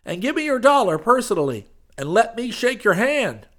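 loud, high glad-handing preacher voice; high preacher figure. Acted. Street preacher pitching to the crowd, loud and high. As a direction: boom it out like a street preacher selling salvation.

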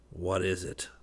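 understated savage retort, kept down in volume; savage echo. A narrator's reading. Trent echoing West's question, with understated savagery. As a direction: throw the question back, softly savage. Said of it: "repeated Trent savagely".

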